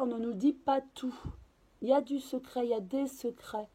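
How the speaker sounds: ordinary delivery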